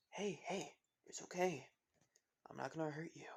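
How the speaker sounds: soft, soothing retold comfort, trailing toward a whisper; softly calm someone who is scared; gentle reassurance. Izuku to a frightened little girl, gentle reassurance.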